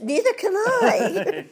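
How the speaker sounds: slow, high, laughing comeback; laughing reply. Retold or imitated. Answering eager fans with a slow, high, laughing quip. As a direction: toss it back with a laugh. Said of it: "And my answer is".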